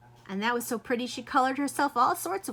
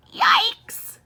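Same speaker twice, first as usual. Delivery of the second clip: shrieked yikes, far higher and louder; a sudden shrill alarm cry; yelled yikes; 14 dB louder than usual. Acted. Mother discovering the permanent markers, shrieked alarm. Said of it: "yelled her mother".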